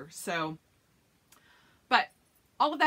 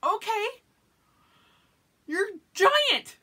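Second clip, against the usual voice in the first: teasing exasperation; 14 semitones higher, 7 dB louder, slower than usual. Retold or imitated. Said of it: "And I'm like".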